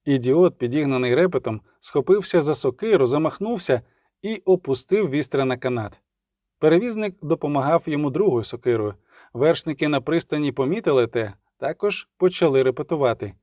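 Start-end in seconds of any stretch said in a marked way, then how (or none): none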